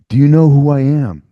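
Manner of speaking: loud, indignant demand through the cell door; retold demanding shout. Locked in a jail cell, demanding the guards notice him, in a loud indignant shout. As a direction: bang on the door and demand to be recognized. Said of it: "I'm not screaming, but I'm knocking".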